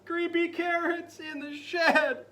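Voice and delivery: high, loud, slow panicked yell; screaming in fright. Acted. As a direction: yell the warning in terror. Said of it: "Jasper screamed".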